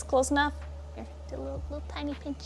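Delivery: ordinary talk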